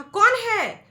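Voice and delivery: high, loud frightened call; a frightened child crying out; scared shout. A narrator's reading. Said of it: "बच्चा बहुत ही परेशान".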